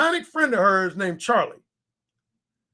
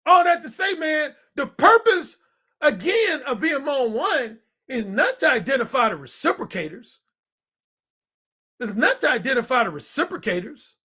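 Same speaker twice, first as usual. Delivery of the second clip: fired-up lecture; 6 semitones higher than usual. Acted. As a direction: drive the point home. Preaching the point to viewers.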